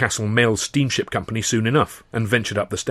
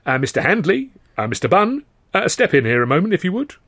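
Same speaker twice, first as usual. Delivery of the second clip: high calling-out to clerks; 8 semitones higher, 4 dB louder than usual. A narrator's reading. Turnbull calls junior clerks into the office, high voice. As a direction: summon the juniors.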